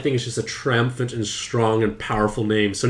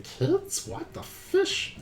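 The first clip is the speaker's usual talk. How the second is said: much higher, hushed, slow pet voice; voicing Pancake; 11 dB quieter, much slower than usual. Pancake the pet reacting to talk of kids, squeaky and scandalised. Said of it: "Pancake is looking at me saying".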